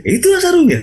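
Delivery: very high-pitched imitated exclamation; exclaiming wife. Wife to husband about the sarong, imitated high exclamation. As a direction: mimic the wife pointing it out, voice shot right up. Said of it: "kata istri".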